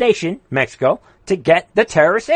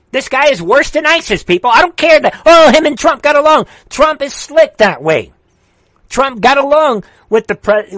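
higher, louder indignant rant; really riled, pitched up; worked up; 7 semitones higher, 4 dB louder than usual. Real, not acted. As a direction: get heated and raise your voice. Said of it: "I don't wanna get worked up".

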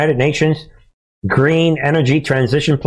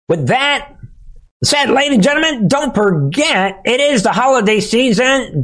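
much higher, hammed-up announcer read; announcer plug; 9 semitones higher than usual. Pitching the sponsor to viewers, high hammed-up announcer. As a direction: sell it like a showman.

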